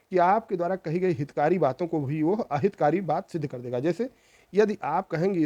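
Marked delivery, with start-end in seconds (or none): none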